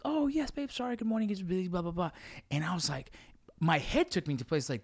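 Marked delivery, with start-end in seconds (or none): 0.0-2.1 much higher, breezy mock wife voice; mimicking wife. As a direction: toss it off like a busy wife brushing him off. Husband mimics wife's text reply.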